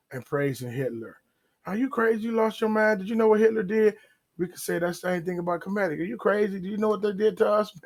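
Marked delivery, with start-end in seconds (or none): 1.7-4.0 higher, scolding disbelief; exasperated rebuke. Acted. Imagined friend rebuking someone praising Hitler, higher exasperated scolding. Acted rebuke of a Nazi sympathizer. 6.0-7.8 higher, scolding disbelief; exasperated rebuke. Acted. Imagined friend rebuking someone praising Hitler, higher exasperated scolding. Acted rebuke of Kemet followers.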